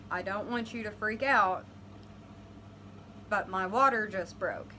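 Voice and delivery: normal talking voice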